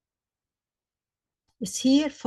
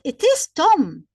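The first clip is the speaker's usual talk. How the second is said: Tom punched louder and higher; stress on Tom; 5 semitones higher, 7 dB louder than usual. Shown as a demonstration. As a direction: lean hard on the name Tom. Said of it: "is to emphasize".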